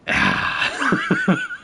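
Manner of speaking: high, wheezing laugh; helpless high-pitched giggle. Real, not acted. Streamer cracking up at his failed accent, laughing.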